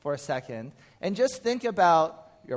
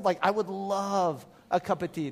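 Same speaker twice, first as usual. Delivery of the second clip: expressive request. Shown as a demonstration. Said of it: "can you be more expressive".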